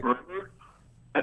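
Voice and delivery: breaking up on a cell phone; choppy, dropping-out phone line; glitching audio. Real, not acted. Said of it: "you're breaking up there".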